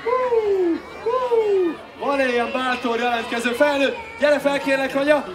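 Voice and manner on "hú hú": excited whoop. Real, not acted. Entertainer whooping at the crowd.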